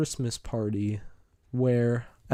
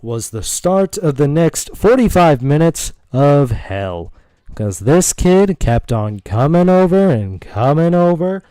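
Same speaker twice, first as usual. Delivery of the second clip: much louder, emphatic storyteller outburst; dramatic exasperation; 4 semitones higher, 11 dB louder than usual. Real, not acted. Telling listeners about a kid who kept bothering him, exasperated. Dramatic announcement of his ordeal.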